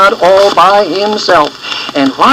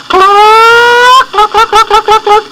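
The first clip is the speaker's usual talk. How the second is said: high, louder clucking call; hen calling; 5 dB louder, slower than usual. A narrator's reading. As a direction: cluck to call the chicks home. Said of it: "Cluck Cluck called".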